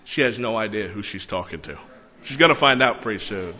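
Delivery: ordinary delivery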